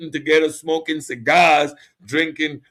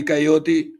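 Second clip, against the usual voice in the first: normal talking voice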